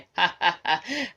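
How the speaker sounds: acted booming villain's laugh; thunderous laugh. A narrator's reading. Stranger announces himself to the village, laughing thunderously. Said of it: "आभाळ गडगडावं तसं कोणतरी हसलं".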